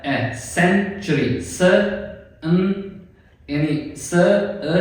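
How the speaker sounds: "सेंचुरी" broken slowly into "स" "अ" "न"; slow syllable breakdown. Shown as a demonstration. Teacher slowly breaking "सेंचुरी" into sounds. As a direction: stretch out each piece of "सेंचुरी" slowly. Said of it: "स के बाद sound कौन सा आ रहा है".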